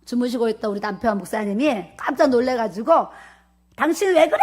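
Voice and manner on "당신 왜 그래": high, startled cry; startled husband's yell. Retold or imitated. Husband woken by wife's scream, startled high yell. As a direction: yelp it like someone shocked awake. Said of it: "소리를 지르고 이제 일어난 거야".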